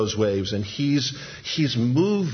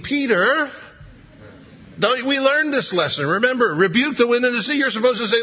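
much higher, incredulous reproach; exasperated disciples; 10 semitones higher than usual. Retold or imitated. The other disciples chiding Peter, incredulous. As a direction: scold him like he forgot the lesson.

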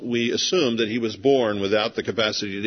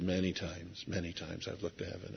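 much softer, tired and resigned; soft weary voice; 7 semitones lower, 19 dB quieter than usual. Retold or imitated. Deaf man answering Jesus' sigh, soft and weary. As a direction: murmur it like a man who gave up long ago. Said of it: "the guy probably said".

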